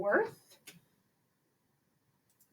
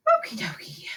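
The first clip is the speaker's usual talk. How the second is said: squeaky okie dokie. Real, not acted. Playful sign-off to the step.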